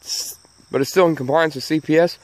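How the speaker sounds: ordinary talk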